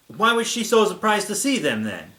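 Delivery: ordinary talk, nothing marked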